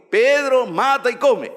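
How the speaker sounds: high and loud command, Pedro called out; God's commanding voice. Acted. God commands Pedro in the vision, loud and high. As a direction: boom it down from heaven.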